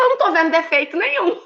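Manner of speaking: gushing over the flat; mock amazement. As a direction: insist there is nothing wrong. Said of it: "Eu falei".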